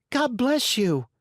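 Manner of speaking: put-on high little boy voice; chirpy puppet boy. Puppet blesses a sneeze.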